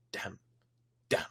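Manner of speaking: ordinary delivery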